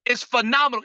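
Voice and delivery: high-pitched, emphatic hype; rally the audience with high-energy praise; energetic demonstration. Shown as a demonstration. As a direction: pitch it way up for them to repeat. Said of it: "Say it with me".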